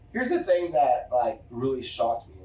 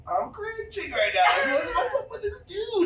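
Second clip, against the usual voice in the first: much higher, half-laughing cry of disbelief; cringing disbelief. Retold or imitated. Telling friends about a bragging bar owner, high laughing cringe. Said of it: "I'm all like".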